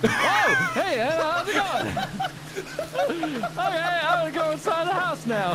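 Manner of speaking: very high, laughing fake-casual greeting; nervous panic. Real, not acted. Greeting demon Anna while backing away, nervously laughing. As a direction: squeak a casual hello through nervous laughter.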